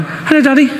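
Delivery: put-on a small girl says hello to her father; little girl voice. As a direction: play a six-year-old greeting her dad. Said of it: "She's only six".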